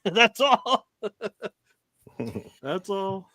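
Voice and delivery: laughing out the words. Real, not acted. Dad brushing off his daughters, still laughing. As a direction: giggle it off.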